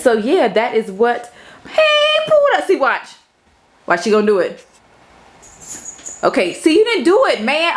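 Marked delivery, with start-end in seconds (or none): 1.7-7.7 much higher, coaxing the cat; high cooing to the cat; high pet-talk voice. Real, not acted. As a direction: coax the cat in a squeaky voice. Calling the cat.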